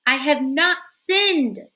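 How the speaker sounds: slow, loud defiant shout. A narrator's reading. Florence shouting at the priest, slow and loud. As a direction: hurl each word back. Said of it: "he shouted".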